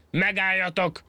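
high, strained mock bellow; a monkey yelling in rage, hammed-up; angry yell. A narrator's reading. Furious monkey yells after the fleeing rabbit, high mock yell. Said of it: "és elordította magát".

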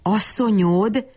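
mildly dumbfounded, stretched-out query; gaping astonishment. A narrator's reading. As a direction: echo the word in mild disbelief, drawn out. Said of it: "tátotta száját Bab Berci".

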